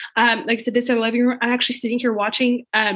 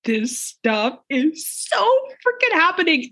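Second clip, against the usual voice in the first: excited; 6 semitones higher, slower than usual. Acted. Insisting the reveals are real.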